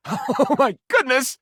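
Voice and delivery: high, slow gasp of delight; overjoyed, can't believe it; oh my, truly thrilled; delighted surprise. Real, not acted. Delighted at offer of help.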